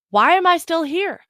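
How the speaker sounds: high, loud imitation of exasperated disbelief; hammed-up frustrated question; mock exasperation. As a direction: act out someone fed up with themselves. Voices the poster's exasperated question.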